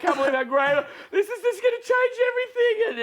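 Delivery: high, loud gushing; mock excitement. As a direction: fake gratitude, high and loud. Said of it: "so excited".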